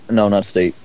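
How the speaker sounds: ordinary delivery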